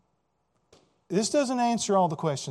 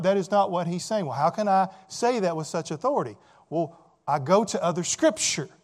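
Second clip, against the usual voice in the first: usual speaking voice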